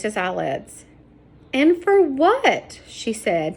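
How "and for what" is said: slow, high, choked words; choking up. A narrator's reading. Sarah kneeling by the dead man, choking up. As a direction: fight back tears, slowly. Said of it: "choking up as she spoke".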